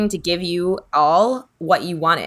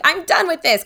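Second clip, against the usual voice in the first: put-on high, whiny kid's protest; tearful child quitting; 10 semitones higher than usual. Small child quitting her project in tears, whiny. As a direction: toss it off like a teary kid done trying. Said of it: "start crying".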